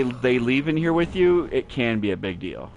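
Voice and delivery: ordinary talk, nothing marked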